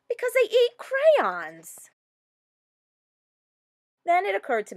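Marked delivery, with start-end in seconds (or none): 0.1-1.9 higher, louder burst of indignation; animated exclaim; excited realization. A narrator's reading. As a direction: pitch it up as if it's obvious. Narrator's outraged realization.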